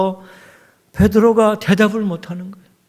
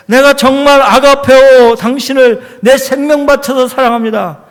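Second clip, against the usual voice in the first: fervent vow; 5 semitones higher, 8 dB louder than usual. Acted. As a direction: proclaim it with his whole life. Peter's unsaid vow, proclaimed. (목숨 걸고 크게 맹세하듯)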